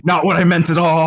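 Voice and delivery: high, loud protest between laughs; exasperated, on the edge of laughter; amused protest. Real, not acted. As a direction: raise pitch and volume in mock exasperation. Protests friend's answer amid laughter.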